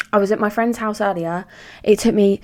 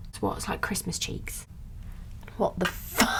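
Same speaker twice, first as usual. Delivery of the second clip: shocked whisper; 11 dB quieter than usual. Real, not acted. Shocked at own makeup.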